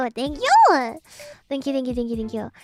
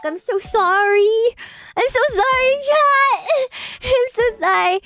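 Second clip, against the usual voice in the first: high mock sobbing apology; high fake-crying sorry to chat; mock wail; 8 semitones higher, 3 dB louder than usual. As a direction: whine it like a fake cry.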